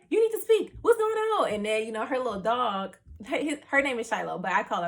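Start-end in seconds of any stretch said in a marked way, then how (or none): 0.1-1.5 high, louder imitation of an insistent sister; alarmed sister. Sister demanding her crying sibling explain, urgent. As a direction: play the sister pressing urgently for an answer.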